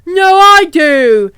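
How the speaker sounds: hammed-up quarrelling shout; mock shout. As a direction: squabble loudly, stretching the last words. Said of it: "he sh-, shouted".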